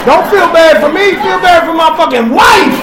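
loud, high plea; emphatic shout. Real, not acted. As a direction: shout it to the crowd. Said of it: "Y'all need to love her".

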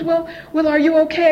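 mock anxious questioning; worried mother. Mother checking on her daughter, high worried voice.

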